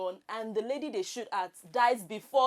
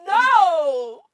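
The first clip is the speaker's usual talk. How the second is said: high shouted "No"; loud protest; 7 semitones higher, 14 dB louder than usual. Real, not acted. Contestant rejecting host's accent, loud shout.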